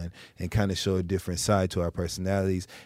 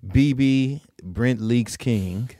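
slow mock announcement; much slower than usual. Joking name mispronunciation.

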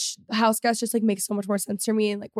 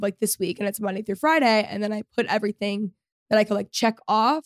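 ordinary delivery

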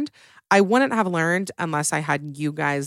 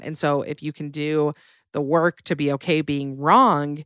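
normal talking voice

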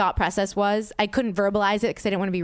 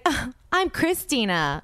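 slow, higher-pitched mimicry of a friend; imitated friend's tone; 4 semitones higher, slower than usual. Friend introducing herself to the driver, slow mocking imitation. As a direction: drag out the introduction, mimicking her. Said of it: "but in that tone of voice".

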